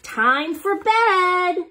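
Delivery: high, slow, stretched out; drawn-out announcement. Acted. Reader opens the monster story, slow and high. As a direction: draw it out with mock drama. Dramatic story opening line.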